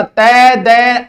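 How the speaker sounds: तय दय very slowly, each letter on its own. Shown as a demonstration. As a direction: sound out तय दय loudly, one letter at a time. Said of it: "एक नए चेप गयो".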